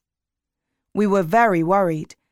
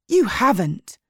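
mildly shocked retort; a touch of startled disbelief; 3 semitones higher than usual. A narrator's reading. Sally answers Frankie's confession, mildly shocked. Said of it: "sounding shocked".

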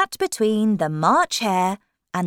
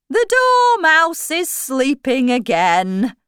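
high, loud Hatter outburst; sudden exclamation; 8 semitones higher, 7 dB louder than usual. A narrator's reading. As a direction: burst out with it in character. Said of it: "Hatter said suddenly".

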